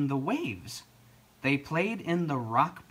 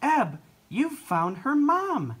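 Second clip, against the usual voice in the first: high, loud, drawn-out delight; excited; 12 semitones higher, 5 dB louder than usual. A narrator's reading. Flo to Ebb on finding the mother, thrilled. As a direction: exclaim it with storybook joy.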